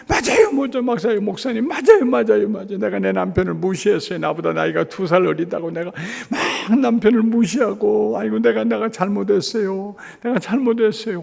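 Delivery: high, breaking tearful voice; tearful confession. Retold or imitated. Wife weeping as she confesses to the pastor, tearful. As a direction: blurt it out through tears. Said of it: "갑자기 울기 시작했어요".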